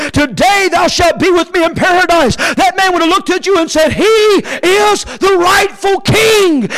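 high, driving scripture preaching; full-force preaching. Acted. Preacher tells of the thief beside Jesus, full-force preaching.

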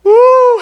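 high loud woo; joyful whoop. Real, not acted. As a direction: shout for joy. Celebratory preacher shout.

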